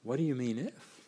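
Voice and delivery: gentle invitation. Pretend. Preacher voicing Jesus gently inviting the doubting father. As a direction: ask it tenderly, as an invitation to trust. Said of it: "Could it be that he's saying".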